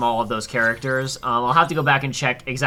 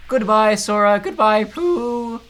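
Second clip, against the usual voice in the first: high, louder timid little pig voice; Piglet voice; 8 semitones higher, 5 dB louder, much slower than usual. Acted. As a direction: call goodbye like tiny Piglet. Voicing Piglet farewell.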